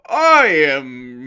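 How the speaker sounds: loud, slow put-on male voice; bad male voice imitation. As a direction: ham up a narrator faking a man. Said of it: "her male voices were terrible".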